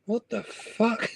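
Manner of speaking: quiet bewildered. Retold or imitated. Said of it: "you think".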